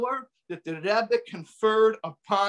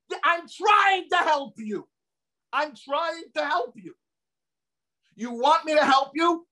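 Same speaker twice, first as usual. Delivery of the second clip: imitating a stern rabbi, higher and slow; stern Rebbe; 8 semitones higher than usual. Rebbe answering the grieving mother, imitated sternly. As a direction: retell his firm answer, slowed and raised. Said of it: "in an uncharacteristically stern way".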